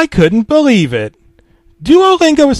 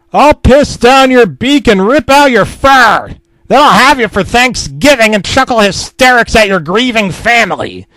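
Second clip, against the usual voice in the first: furious shout; 6 semitones higher, 5 dB louder than usual. A narrator's reading. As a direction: roar threats at the owl. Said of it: "I shouted at the beastiest beast".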